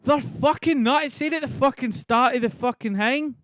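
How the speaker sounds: loud, high exasperated protest; irritated retort. Real, not acted. Arguing back at chat's claim.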